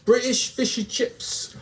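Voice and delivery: lightly put-on British accent on British fish and chips; mock British accent. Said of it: "offended by our accent".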